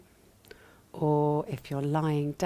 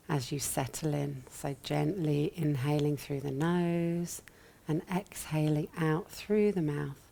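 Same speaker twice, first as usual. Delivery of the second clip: ordinary talk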